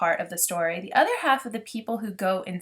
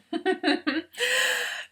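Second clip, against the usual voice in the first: real laugh, much higher than her voice. Real, not acted. Laughing at her own accent attempt, high giggle. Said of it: "So I'm laughing because that was my English accent".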